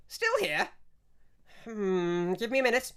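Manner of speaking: put-on a fussy old priestess, impatient. Priestess pops up over altar.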